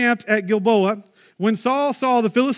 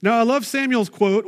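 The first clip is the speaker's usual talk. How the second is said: normal talking voice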